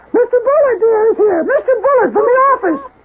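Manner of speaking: high falsetto wife calling upstairs; wife's voice. Retold or imitated. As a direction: call it out in a piercing imitation of a wife. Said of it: "his wife's voice says".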